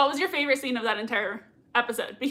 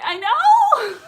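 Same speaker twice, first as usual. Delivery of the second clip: much higher, loud, laughing squeal; excited squeal; 9 dB louder than usual. Real, not acted. Host squeals agreement with a chatter, excited. Agrees about Skye and Coulson.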